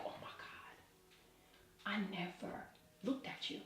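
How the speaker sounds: breathy hushed whisper. Retold or imitated. Mother whispering her realisation to her daughter. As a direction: whisper it, almost no voice.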